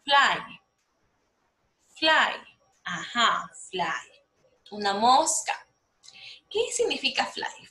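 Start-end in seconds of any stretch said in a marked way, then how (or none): 0.0-2.3 "Fly" said singly and firmly, with a pause after; model pronunciation. Shown as a demonstration. Teacher asking pupils to repeat after her, model pronunciation of "Fly". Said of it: "Please, repeat after me".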